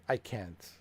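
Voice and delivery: ordinary delivery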